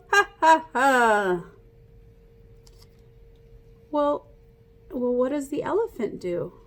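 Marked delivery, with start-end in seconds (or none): 0.1-1.4 loud cackling hyena laugh; playful mock hyena cackle. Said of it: "I liked making the noises".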